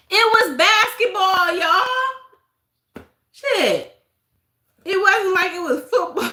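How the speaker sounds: high, loud and laughing; laughing exasperation. Real, not acted. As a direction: laugh it out in disbelief.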